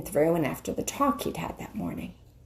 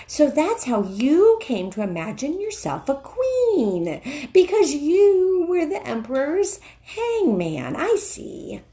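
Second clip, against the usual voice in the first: higher, scornful beaver voice; indignant beaver; 8 semitones higher than usual. Acted. Mister Beaver scornfully exposing the witch. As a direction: sneer at her claim.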